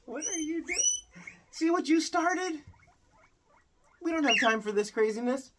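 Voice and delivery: laughing frustration at a hyper pet; exasperated at pet. Real, not acted.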